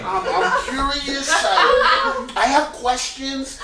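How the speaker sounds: high, squeaky, eager and curious with laughing breaks. Shown as a demonstration.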